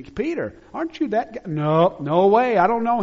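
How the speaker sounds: loud, high panicked denial. Acted. Peter denying Jesus to a questioner, loud and high. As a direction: push them off, insisting loudly.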